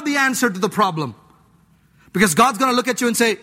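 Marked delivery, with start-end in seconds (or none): none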